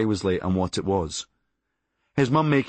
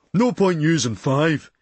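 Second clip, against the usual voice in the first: slow, louder scoffing put-on voice; 5 semitones higher, 4 dB louder, slower than usual. Science class partner scoffing at the weights, slow and loud. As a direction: scoff it out like a dim lab partner. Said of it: "he scoffed".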